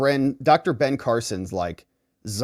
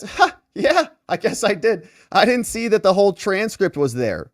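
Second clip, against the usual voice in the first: witness; 6 semitones higher than usual. Retold or imitated. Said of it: "She says".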